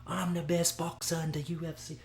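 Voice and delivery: high-pitched, badly faked Hawaiian accent; horrible Hawaiian accent. Fight fan imitating a boxer, bad Hawaiian accent. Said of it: "That's a horrible Hawaiian accent".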